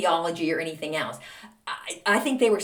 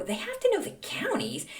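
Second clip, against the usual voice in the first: incredulous; 13 semitones higher, 4 dB quieter than usual. Retold or imitated. Asking teacher in disbelief.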